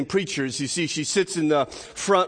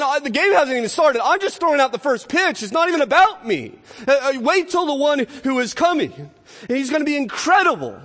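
high, loud, eager deflection; excited build-up; 8 semitones higher, 4 dB louder than usual. Retold or imitated. John saying it's not about him, excited build-up. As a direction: hype the one to come, as an acted herald. Acting John hyping the coming one.

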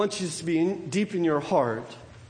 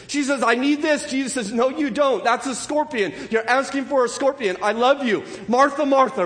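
higher and louder, driving the point home; preaching at full force; 5 semitones higher, 3 dB louder than usual. Acted. Preacher to congregation, passionate preaching. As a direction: preach it with rising fire. Preacher builds on Martha story.

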